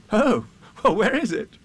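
put-on high eager boy's voice; a young boy pleading with Owl; urgent Christopher Robin. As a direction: rush to the rescue like a small boy.

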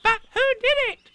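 very high, loud little squeak; high-pitched, squeaky child-animal voice; Roo. A narrator's reading. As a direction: pipe up like an eager little one. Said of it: "asked Roo".

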